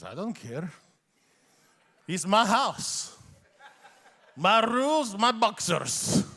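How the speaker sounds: imitating his Iranian dad's thick accent, slow and defiant; a stubborn Iranian father in his own home. Son retells his dad refusing to dress for guests, Iranian accent. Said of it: "They just have different accents".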